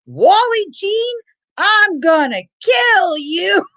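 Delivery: slow, high-pitched mock yell; retold threat. Dad to aunt as the ride goes out the window, slow mock yell. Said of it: "he didn't say it that politely".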